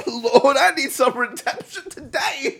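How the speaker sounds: high, laughing cry to heaven; playful churchgoer's wail; mock revival plea. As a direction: beg the Lord, hammed up through giggles.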